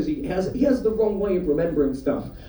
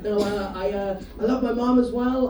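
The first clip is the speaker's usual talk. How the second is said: ordinary talk, nothing marked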